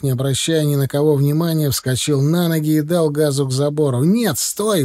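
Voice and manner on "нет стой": high-pitched warning call; contained narrator's shout, pitched up; crying out. A narrator's reading. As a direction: call out urgently without real volume. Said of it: "выкрикнул я".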